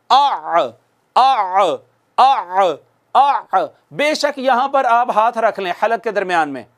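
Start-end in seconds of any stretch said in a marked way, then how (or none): 0.1-3.7 आ pressed from the middle of the throat; throaty ain drill. Shown as a demonstration. Teacher drilling students on the mid-throat ain. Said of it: "पढ़िए मेरे बाद".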